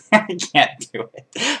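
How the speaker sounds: high, slow, cracking up in real laughter; laughing. Real, not acted. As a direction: give up through laughter. Cracks up after failed accent.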